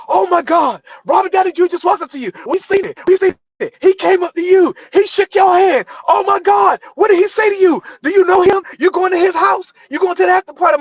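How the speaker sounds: very high, loud, fast gushing chatter; excited crowd of extras. Retold or imitated. Background extras mobbing him after Downey left, high and loud. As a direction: gush like a starstruck crowd.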